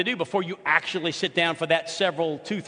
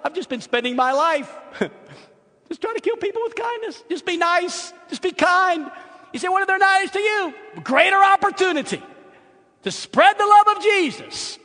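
much higher and louder, rising sermon fervour; full-force preaching; 12 semitones higher, 6 dB louder than usual. Acted. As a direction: preach it out to the congregation. Preaching climax on kindness.

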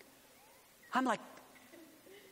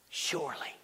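a single soft whispered word; 7 semitones lower, 5 dB quieter than usual. Real, not acted. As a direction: let it out on a hushed breath.

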